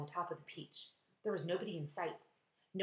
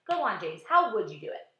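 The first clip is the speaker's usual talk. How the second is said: ladybug voice; 7 dB louder than usual. A narrator's reading. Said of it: "said the ladybug".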